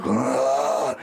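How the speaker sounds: deep guttural growl, low in the chest; low beast growl. Retold or imitated. Crouched on the ground, growling at the gang in a low voice. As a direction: growl like a wild animal. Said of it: "I'm like".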